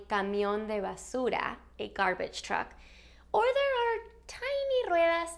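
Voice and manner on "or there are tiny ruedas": high little voice for something small; much higher, teeny-weeny delivery; tiny voice. Shown as a demonstration. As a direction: squeak it up high to show tininess. Illustrates tiny versus big wheels.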